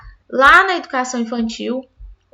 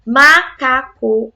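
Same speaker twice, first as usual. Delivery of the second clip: "macaco" slowly split into ma-ca-co; syllable by syllable; 4 dB louder, much slower than usual. Shown as a demonstration. Teacher modelling how a child pronounces the word, syllable by syllable. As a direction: sound out each piece of "macaco". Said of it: "quando ela pronunciar a palavra macaco".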